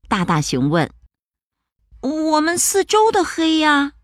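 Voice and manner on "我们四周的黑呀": high small cub voice; timid little bear. Acted. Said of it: "小小熊说".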